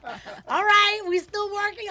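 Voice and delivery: high, loud indignant shout; shocked shout. Retold or imitated. Shocked by cursing friend, shouting.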